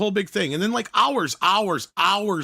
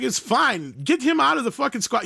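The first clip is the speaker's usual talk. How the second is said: put-on high-pitched mouthy kid retort; going off; 12 semitones higher than usual. Smart-mouthed kid to a cop, going off. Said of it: "he's just going off on the cop".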